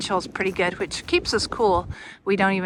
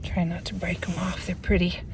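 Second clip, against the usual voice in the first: whispering; 2 semitones lower, 5 dB quieter than usual. Real, not acted. Whisper near delicate ice.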